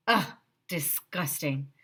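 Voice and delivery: grossed-out groan in odd voice; disgusted. Acted. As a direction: sound totally grossed out. Kid grossed out by girl.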